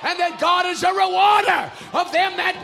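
high, loud scripture proclamation; full-force preaching. Real, not acted. Preacher declaring God's promise to the church, full-force preaching. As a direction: thunder the promise, stretching key words.

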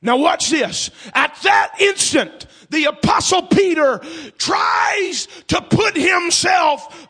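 loud, slow, high hammering sermon; full-force preaching. Acted. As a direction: drive it home to the congregation.

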